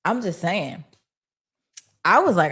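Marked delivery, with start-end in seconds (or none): none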